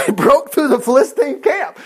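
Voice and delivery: laughing through the line. Real, not acted. Preacher laughing as he repeats the bold feat for the listeners. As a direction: chuckle as you repeat it.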